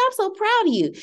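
proud mom. Retold or imitated. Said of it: "I said".

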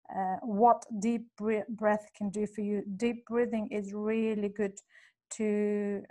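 ordinary talk

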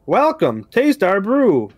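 a cheery machine voice; robot voice. Acted. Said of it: "you hear a robotic voice".

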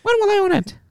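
piercing squeak, very high; squeaky cartoon mouse asking; Mickey Mouse voice. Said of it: "I'm gonna do the Mickey Mouse voice".

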